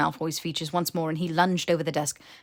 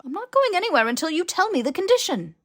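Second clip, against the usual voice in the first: higher, louder, stubborn demand; defiant insistence; 12 semitones higher, 7 dB louder than usual. Acted. Harry refusing to leave until Malfoy answers, defiant. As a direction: plant your feet and insist.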